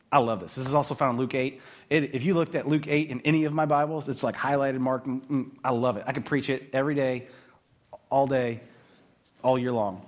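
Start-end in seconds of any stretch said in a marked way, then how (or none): none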